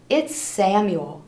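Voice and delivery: plain reading voice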